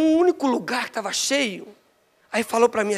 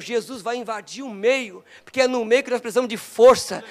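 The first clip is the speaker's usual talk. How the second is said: builds louder to a shout at the end; preaching at full force; 7 dB louder than usual. Acted. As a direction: drive it home to the congregation. (pregação crescendo até gritar)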